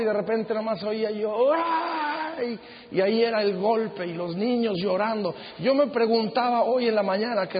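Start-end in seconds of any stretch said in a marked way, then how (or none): 1.5-2.6 long, high "ay" without volume; imitated cry of pain. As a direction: mimic a battered wife's drawn-out wail, held back. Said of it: "oía a esta mujer gritando". (quejido de dolor imitado, agudo y alargado)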